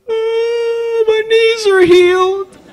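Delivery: high, loud, drawn-out cry of relief; retold crying scream. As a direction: re-enact an overwhelmed scream of joy. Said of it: "crying and screaming like that".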